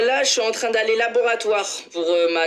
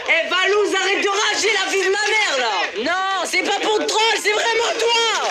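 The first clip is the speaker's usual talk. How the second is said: excited shouting; 10 semitones higher than usual. Real, not acted. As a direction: yell at him, all worked up. Shouting at a rival in the game.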